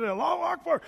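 high, called-out Allahu Akbar; repeated call. Retold or imitated. The man repeating his cry to the crowd, high call. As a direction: call it out again. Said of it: "and he said".

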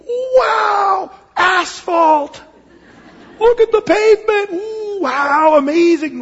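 high, loud, hammed-up gushing; mock amazement. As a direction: gush as if awestruck by something utterly ordinary. Mock gushing over asphalt.

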